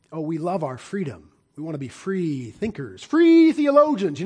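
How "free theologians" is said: much higher and louder, slowed and scornful; mocking. Real, not acted. Preacher mocking free thinkers before the congregation, scornful high jab. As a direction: sneer at the idea.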